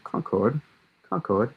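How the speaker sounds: Concord as CON-cord, second syllable given full vowel; British-style pronunciation. Shown as a demonstration. Forecaster guessing how the British say it, Concord as CON-CORD. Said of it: "if you were maybe British".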